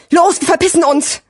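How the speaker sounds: high, agitated call to flee; urgent command. A narrator's reading. Gigi tells Sirio they must flee, urgent. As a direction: urge a friend to get moving now.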